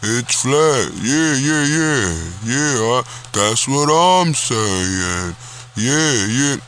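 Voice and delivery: slow, dopey imitation of a know-it-all; mock flat-earth guy. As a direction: drag it out like a clueless guy nodding along. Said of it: "he seems like that type of".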